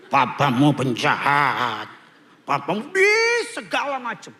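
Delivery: drawn-out, mock-sinister accusation; hammed-up mother badmouthing the father; indoctrinating mother. Mother indoctrinating her child against papa, hammed-up accusing voice.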